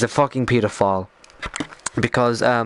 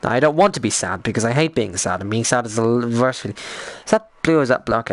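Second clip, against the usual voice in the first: normal talking voice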